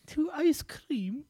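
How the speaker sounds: high, drawn-out mock British voice; mock British accent. Mock British reading of recipe.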